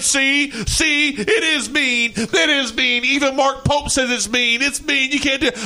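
high, whiny mock complaint; mock whiny texter. Co-host mocking a Texas fan who whines. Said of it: "does he text like".

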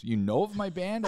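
pleased he knows the band, asking back; retold surprise. Retold Joe's surprised question.

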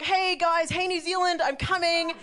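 high, drawn-out gleeful call; excited announcement. Retold or imitated. Ringing the consulate after the film, high and elated.

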